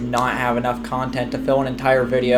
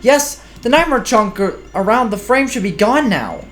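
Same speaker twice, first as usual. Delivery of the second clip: character voice; 7 semitones higher than usual. Acted. Voicing game character dialogue.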